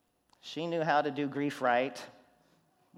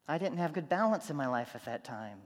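ordinary talk, nothing marked

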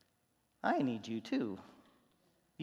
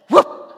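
much louder sharp whoop imitating a whip; whip crack whoop; 21 dB louder than usual. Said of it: "he goes like this".

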